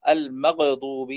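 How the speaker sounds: slow المغضوب with an echo after gh; bounced ghayn. Shown as a demonstration. Teacher showing a mistake, المغضوب with qalqala on ghayn. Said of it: "أحيانًا يقلقلها".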